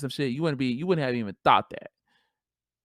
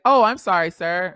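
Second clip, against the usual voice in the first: mock apology; 5 semitones higher, 9 dB louder than usual. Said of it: "gonna be like".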